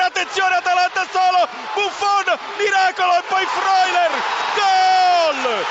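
building to a shouted goal; rising excitement, then shout. Real, not acted. Late equaliser, rising to goal. (telecronaca che sale fino all'urlo del gol)

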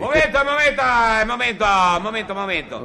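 high, hurried call to wait; high-pitched "hold it" call; urgent calling out. Acted. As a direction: call out to hold the lift, easing off at the end. Calls to hold the lift.

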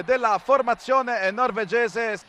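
normal talking voice

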